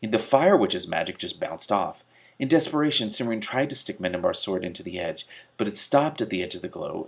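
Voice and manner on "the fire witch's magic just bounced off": ordinary delivery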